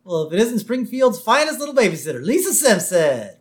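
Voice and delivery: high, swooping mock-jovial cop voice; loud, hammed-up cartoon officer greeting; Chief Wiggum. Police chief greeting the babysitter he stopped, hammed-up and loud. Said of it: "He's like".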